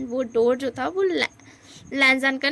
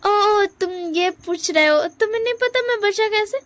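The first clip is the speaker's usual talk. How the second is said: flustered high dodge; 7 semitones higher, 4 dB louder than usual. Acted. Wing dodges question.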